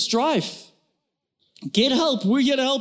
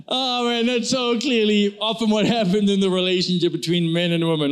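ordinary talk, nothing marked

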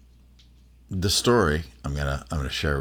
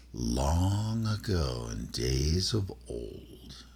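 slow, soft, eerie reading of the witch's verse, own voice; spooky poem recitation; 3 semitones higher, 5 dB quieter, slower than usual. Acted. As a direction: recite it like a ghost story in the dark, slowly. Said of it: "I'll do it in my voice".

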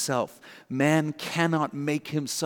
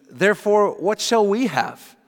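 Peter's question; 7 semitones higher, 5 dB louder than usual. Retold or imitated. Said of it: "Peter adds on ... the question".

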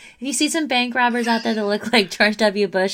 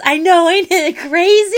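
high, loud and slow imitation; hammed-up line, much louder; mock movie quote; 9 semitones higher, 10 dB louder than usual. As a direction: stretch out crazy in a giddy yell. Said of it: "And he goes".

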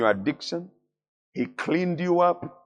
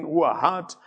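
ordinary talk

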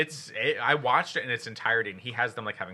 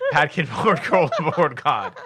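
laughing; 2 semitones higher, 5 dB louder, much slower than usual. Real, not acted. Laughing through tongue twister.

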